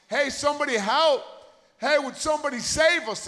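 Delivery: acted panicked yell, short of a scream; mock screaming. Boys in a storm-tossed boat calling for rescue, mock screaming. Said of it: "so they start screaming".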